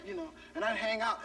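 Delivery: usual speaking voice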